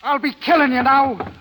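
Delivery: choked high fury; menacing threat. Acted. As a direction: rage barely held. Jealous lover threatens her.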